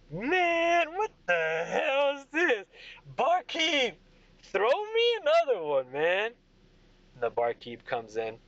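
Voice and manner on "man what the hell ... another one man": put-on high, drawling drunk demanding another round; Craig. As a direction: call across the bar, drunk and pushed high. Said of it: "He's like".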